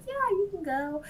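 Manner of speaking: lightly voiced high Green Knight; Green Knight permitting. Green Knight granting Squirrel leave, lightly voiced. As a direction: toss off a casual yes. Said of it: "And he's like".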